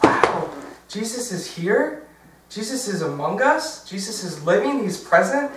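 high, awed questions, rising at the ends; imitating a little girl's wide-eyed wonder; childlike wonder. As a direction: marvel like an amazed child. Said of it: "that childlike wonder was there".

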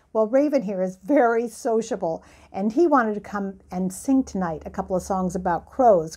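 normal talking voice

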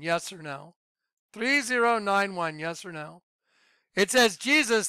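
normal talking voice

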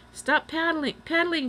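high-pitched urgent order; alarmed shout. A narrator's reading. Boy afraid of diving into the ground, shouting. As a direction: call out to avert a crash. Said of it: "shouted Jack".

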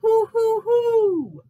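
high, loud hoots; ringing owl calls. A narrator's reading. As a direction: hoot three times like an owl. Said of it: "I hoot and hoot".

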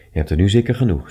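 ordinary delivery, nothing marked

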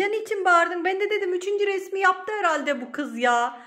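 loud, high-pitched protest; indignant complaint. Real, not acted. Woman asking why she was called over, indignant complaint. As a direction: complain loudly that you were misled.

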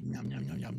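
soft, low nom-nom chewing noise; mock munching. Dad eating noisily.

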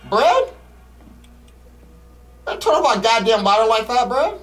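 high exasperated complaint; annoyed outburst. Real, not acted. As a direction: snap at your teammate. Ladder turned off again.

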